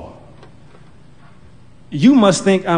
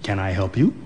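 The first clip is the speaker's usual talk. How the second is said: friendly clerk; 8 semitones lower, 6 dB quieter than usual. Acted. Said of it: "a friendly".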